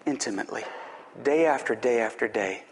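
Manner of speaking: ordinary talk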